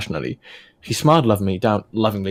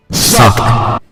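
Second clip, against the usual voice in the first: mock husky whisper; 8 dB louder than usual. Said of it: "before whispering huskily".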